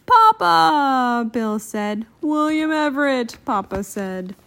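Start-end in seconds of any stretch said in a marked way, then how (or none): 0.1-1.3 high, loud, drawn-out cry; overjoyed, stretched exclamation; joyful recognition. A narrator's reading. Bill recognises his lost father. 2.2-3.4 tender high exclamation; joyful reunion cry. A narrator's reading. Father answers son in reunion.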